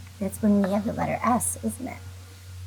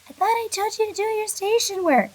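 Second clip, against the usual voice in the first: mock parent, pitched up high; scolding parent; 11 semitones higher than usual. Parent mock-scolding the child about station work, high. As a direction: play a nagging parent, lightly. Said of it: "gonna say to you".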